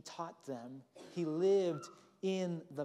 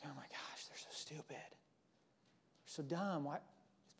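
mocking whisper; 8 dB quieter than usual. Preacher voicing a scornful God, hushed and mocking. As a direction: sneer it under the breath.

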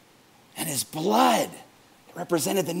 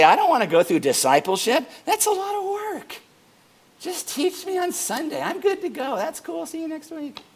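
higher, breezy, easygoing brush-off; casual brush-off; 9 semitones higher than usual. Retold or imitated. Imitating a member skipping discipleship in a breezy, higher voice. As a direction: toss it off like it's no big deal. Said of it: "and say".

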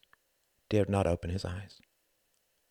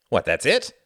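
loud, higher exasperated question; 6 semitones higher, 9 dB louder than usual. A narrator's reading. Jorgen reacts to the brief sentence, exasperated. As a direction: blurt it in exasperation. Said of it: "said Jorgen in exasperation".

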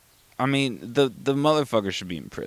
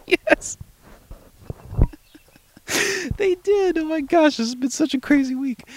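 giddy laughing squeal. Real, not acted. Laughing about the crazy week.

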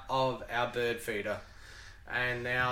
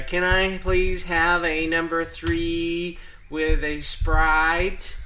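loud, slow, higher-pitched fake American accent; 6 semitones higher, 8 dB louder, much slower than usual. Shown as a demonstration. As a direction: put on an exaggerated American accent to be understood. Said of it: "put on a fake American accent".

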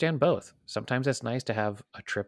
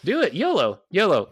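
excited; 8 semitones higher, 7 dB louder than usual. Real, not acted. Urging listener to go.

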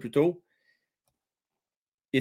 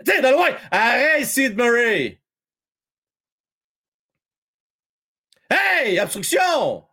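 excited hockey call; 6 semitones higher, slower than usual. Real, not acted. Commentator yelling at the play on the ice, excited. Live hockey action, calling out.

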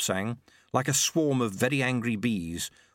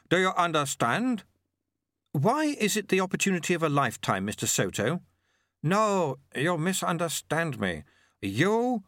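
a stranger's patient, slightly higher sales pitch; lightly voiced Soto; 7 semitones higher than usual. A narrator's reading. Soto coaxing the boy into joining, lightly voiced. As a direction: coax the boy like a smooth recruiter.

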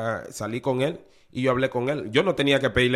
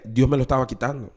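usual speaking voice

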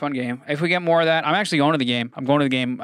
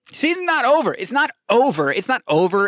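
louder and higher, stepping down each time; defiant insistence; 10 semitones higher, 6 dB louder than usual. Real, not acted. Fan insisting the Knicks season isn't over, loud. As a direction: insist it three times, fading.

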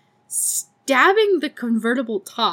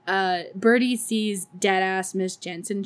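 usual speaking voice

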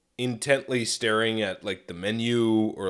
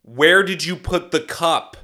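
loud, slow, higher call; mock raised-voice question; shouting for deaf ears; 4 semitones higher, 7 dB louder, slower than usual. Shown as a demonstration. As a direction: project every word to someone half deaf. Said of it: "going like".

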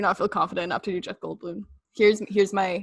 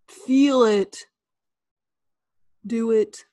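slow, earnest pep talk; Janet quote; 3 dB louder, slower than usual. Acted. Friend guessing game, Janet's earnest uplifting quote. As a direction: lift each phrase like sincere encouragement. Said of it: "give you the quote".

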